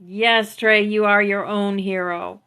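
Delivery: ordinary talk, nothing marked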